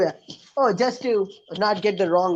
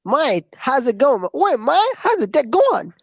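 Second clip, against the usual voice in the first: higher-pitched mock Australian greeting; Australian accent; 6 semitones higher, slower than usual. Trying out accents on a friend, playful Australian accent. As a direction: put on a playful Aussie lilt, pitching it up. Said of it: "Australian accent".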